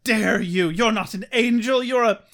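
loud, high, melodramatic outrage; hammed-up affronted wailing; mock indignant mom. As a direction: scold like a wronged mother in a melodrama.

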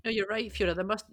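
ordinary talk, nothing marked

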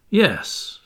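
plain reading voice

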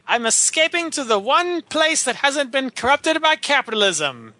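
high, hammy cartoon villain; Tim Curry villain. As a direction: gloat like a pompous cartoon villain. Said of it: "Some of Tim Curry's best work".

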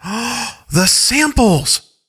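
high, drawn-out exclamation after a gasp; gasp, then let it dawn slowly on a high pitch; sudden realization. Acted. Parker piecing the mystery together, in sudden realization.

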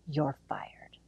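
soft unvoiced whisper, low; a whispered, gloating dismissal; mock whisper. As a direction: breathe the firing line out as a secret. Imagined Trump firing Fauci.

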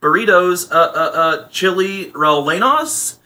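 put-on slow, lilting Valley girl reading a menu; mock Valley girl. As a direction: stretch the dish names like a Valley girl. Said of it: "literally sounds like a California Valley girl".

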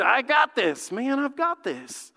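acted overconfidence, drawn out at the end; cocky self-assurance. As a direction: play someone breezily sure they need no help. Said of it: "that's our attitude".

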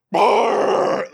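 very loud, high blurted roar; imitated screaming caller. Host mimicking a caller screaming down the phone, mock roar. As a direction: blast out a mock scream as noise. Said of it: "just start screaming at me".

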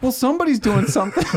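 high-pitched mock exasperation; imitated fed-up coworker; exasperated accusation.

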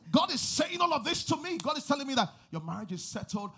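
loud, high sudden preacher's cry; preaching burst. Acted. Pastor proclaiming to the couple, loud and high. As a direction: burst out with conviction.

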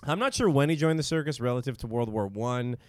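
normal talking voice

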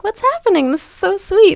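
very high, gushing delight; delighted squeal. Retold or imitated. Surprised by roses, gushing in a very high voice. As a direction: gush like you just got flowers. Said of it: "And I was like".

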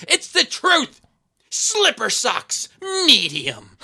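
high, slow mocking lady voice; mock old lady. Retelling The Ref, one woman mocking the grandmother's cheap gifts, high slow mock. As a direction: drag out the gift list with scorn.

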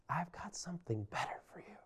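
whispered, intimate promise; God whispering a tender promise to Israel. Acted. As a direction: lean in and breathe it like a secret.

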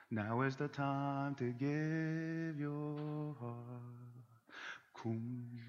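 soft, slow worship leader. Retold or imitated. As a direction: lead the congregation gently.

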